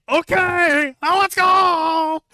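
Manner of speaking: rally the squad in a squeaky mock-heroic voice; hammed-up eager soldier. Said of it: "is like".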